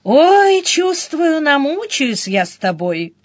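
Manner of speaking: weary lament, high drawn-out start falling away; sighing. A narrator's reading. Sister despairing of Denis, with a sigh. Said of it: "проронила со вздохом".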